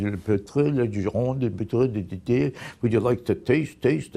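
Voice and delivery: lightly accented mock French sommelier; a hint of a French accent offering a taste; mock French wine waiter. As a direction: imitate a fussy French wine waiter, half-hearted. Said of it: "comes over to you and says".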